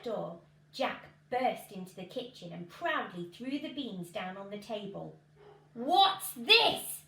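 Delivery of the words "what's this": outraged, high and loud; exclaiming. Acted. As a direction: burst out in shocked anger, much louder. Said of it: "exclaimed his mum".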